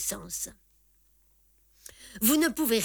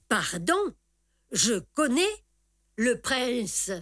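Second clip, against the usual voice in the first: firm voice; 3 semitones higher, slower than usual. A narrator's reading. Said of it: "d'une voix très ferme".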